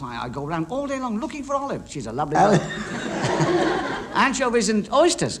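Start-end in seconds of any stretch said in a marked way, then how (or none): 2.6-4.1 silent wheezing laugh; breathy laugh. Real, not acted. Comedian wheezes while joking about olives. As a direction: laugh without voice. Laughs during food joke.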